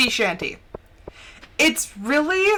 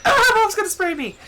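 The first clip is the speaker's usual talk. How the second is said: much higher, louder panicked pet voice; panicked cat voice; 10 semitones higher, 4 dB louder than usual. Pretend. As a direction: squeal it like a scared cat. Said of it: "he's like".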